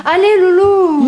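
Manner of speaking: put-on high, childish plea to join the game; little girl voice. Little sister begging to play the video game, childish high voice. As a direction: ask sweetly like a small girl.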